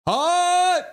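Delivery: high, loud, held shout; excited holler; cheering yell. Real, not acted. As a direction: let one word rip like a fan. Cheering after the scrap.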